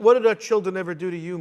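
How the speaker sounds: normal talking voice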